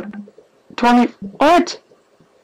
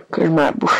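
ordinary delivery

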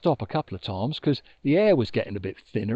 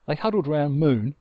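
plain reading voice

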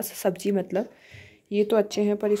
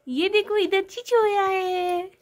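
put-on higher, slow cutesy baby voice; baby talk; 9 semitones higher, much slower than usual. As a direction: coo it slowly like talking for a toddler. Mother cooing at sulking toddler. (धीमी, ऊँची, तोतली बच्चों वाली आवाज़)

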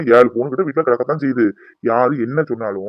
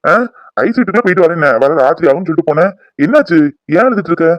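raised, startled annoyance; exasperated rapid-fire questioning; irritated scolding; 4 semitones higher, 3 dB louder than usual. Acted. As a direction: scold her in loud bewilderment. Woken husband scolds his weeping wife.

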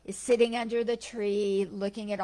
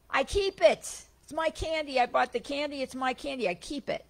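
high, possessive insistence; stubborn answer; 6 semitones higher, 3 dB louder than usual. Retold or imitated. Heckler answering the Buddha's riddle, higher stubborn insistence. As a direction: clutch it like it's yours.

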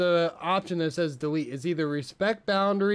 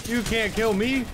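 gloating yell, much higher and louder; triumphant shout; 8 semitones higher, 6 dB louder than usual. Real, not acted. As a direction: crow it at the enemy. Taunting enemy after surviving.

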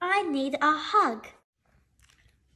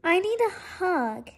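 higher, small pleading voice; looking up; 5 semitones higher than usual. A narrator's reading. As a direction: ask it upward in a higher voice. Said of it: "looking up into Polar Bear's face".

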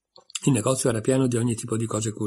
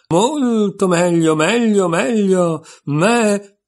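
much higher, louder and slow, turning into a bleat; ever shriller voice; 8 semitones higher, 7 dB louder, much slower than usual. A narrator's reading. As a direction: stretch it out until it becomes a sheep's bleat. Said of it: "con una voce che si andava facendo sempre più stridula".

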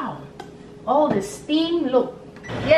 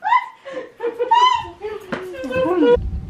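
long high-pitched laugh rising at the end; 8 semitones higher than usual. Real, not acted. Host laughing hard at grandma coughing on the food. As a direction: burst out laughing.

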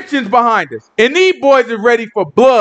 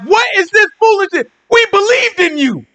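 indignant disbelief; 8 semitones higher than usual. Acted. Israelites confronting Moses they believed in, indignant. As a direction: cry out in disbelief.

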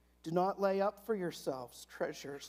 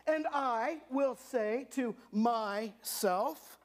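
higher, louder, slow and self-satisfied; boastful rich man; 7 semitones higher, 3 dB louder, slower than usual. Acted. Pastor acting the rich man gloating to himself, higher, louder and slow. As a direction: puff up like a smug landowner planning bigger barns. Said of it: "And so he said".

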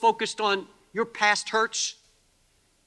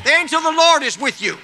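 shouted louder and higher, driving faster; fast preaching shout; 6 semitones higher, 7 dB louder, faster than usual. Acted. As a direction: fire it at them quickly.

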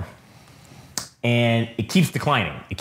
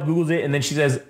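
ordinary delivery

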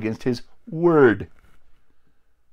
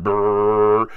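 a loud buzzing brrr like a blaring horn; loud horn noise; 5 dB louder than usual. Retold or imitated. Preacher imitating God's word as a loud horn, buzzing brrr. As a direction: blast out a horn sound with the lips. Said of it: "like a loud horn".

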